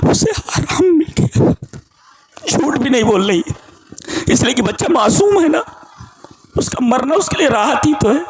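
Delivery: lifted, urgent sermon voice; excited preaching. Acted. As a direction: marvel at the mother's answer. Preacher marvels at her words.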